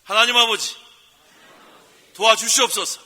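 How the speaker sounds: loud, high cry to God; loud prayer demo. Shown as a demonstration. Pastor leads the congregation in a shouted prayer, loud. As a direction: cry it out for the people to repeat. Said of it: "한번 따라해보세요".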